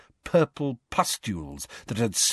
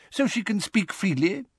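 high, fretful question; impatient, pitched up; Fudge, impatient; 11 semitones higher than usual. A narrator's reading. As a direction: demand a fix impatiently. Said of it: "Fudge asked Umbridge impatiently".

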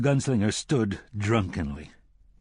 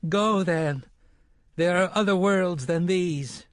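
put-on boy's voice; 8 semitones higher than usual. A narrator's reading. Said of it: "the boy spoke from too far beneath him".

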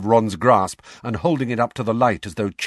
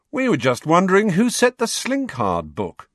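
conversational; 9 semitones higher, 4 dB louder than usual. A narrator's reading. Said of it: "said Fred conversationally".

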